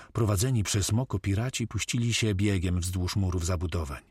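ordinary delivery

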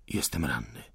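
faint voice of the wounded. Acted. Wounded insurgent answers.

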